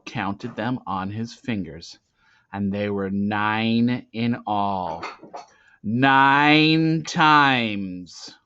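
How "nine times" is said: usual speaking voice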